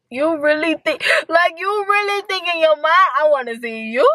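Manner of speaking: mock disbelief. Mocking imagined person.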